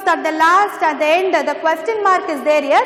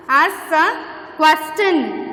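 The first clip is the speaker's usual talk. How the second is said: slow dictation; much slower than usual. Shown as a demonstration.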